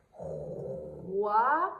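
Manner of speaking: "roi" very slow, long R gliding into wa; blending the sounds. Shown as a demonstration. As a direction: stretch "roi" from its R into a rising vowel. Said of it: "nous avons le R qui fait R".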